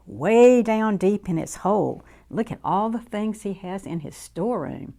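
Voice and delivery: plain reading voice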